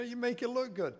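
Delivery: normal talking voice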